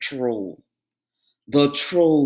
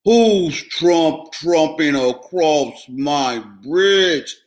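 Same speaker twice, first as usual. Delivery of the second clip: loud, slow roaring troll; 6 dB louder, much slower than usual. A narrator's reading. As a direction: bellow up from under the bridge. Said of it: "A roar rose up from below".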